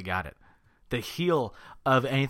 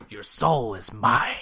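Mortal Kombat quote; slower than usual. Retold or imitated. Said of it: "It's from Mortal Kombat".

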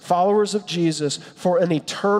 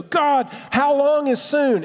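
pleading with God; 8 semitones higher, slower than usual. Pretend. Voicing people pleading with God.

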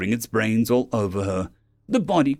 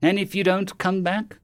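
higher-pitched, insistent question; insistent alien captive, pitched up; pressing; 8 semitones higher than usual. A narrator's reading. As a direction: press them for an answer. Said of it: "the Kerrang pressed".

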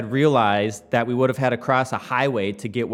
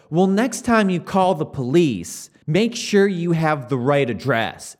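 higher, slower, louder mock of a condescending dispatcher; snooty dispatcher; 6 semitones higher, 5 dB louder than usual. Storyteller mocking the Chicago police dispatcher who hung up, snide and slow. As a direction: lecture him like a smug official, dragging it out. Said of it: "The dispatcher has the gall to go".

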